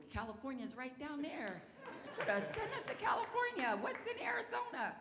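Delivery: very high, squealed protest; hammed-up high-pitched pleading; mock complaint. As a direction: wail comically to the Lord. Acts out complaint to the Lord.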